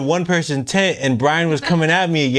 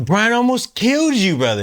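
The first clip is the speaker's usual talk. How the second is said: higher-pitched, emphatic disbelief; retold incredulous telling-off; 9 semitones higher than usual. Friend tells him what he almost did, incredulous. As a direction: press it on him, astonished.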